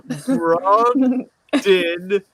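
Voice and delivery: slow, careful sounding out. Real, not acted. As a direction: sound out the name slowly. Struggling with name.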